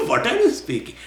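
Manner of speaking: high question broken by a laugh. Pretend. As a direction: laugh while asking it again, amused. Question repeated through laughter.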